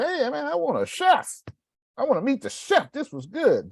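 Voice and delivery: high, bright-eyed diner calling for the chef; excited customer. Retold or imitated. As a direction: pitch it way up like a thrilled customer. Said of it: "when they come and say".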